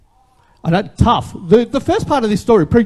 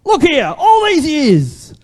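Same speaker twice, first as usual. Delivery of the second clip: angry son; 6 semitones higher, slower than usual. Retold or imitated. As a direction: throw the years of grievance at the father, slowly. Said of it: "In his anger coming out".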